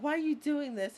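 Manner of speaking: high, loud terrified plea; terrified victim. Retold or imitated. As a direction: beg like a horror victim. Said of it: "She's like".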